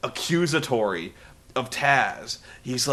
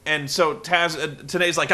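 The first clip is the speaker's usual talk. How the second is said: usual speaking voice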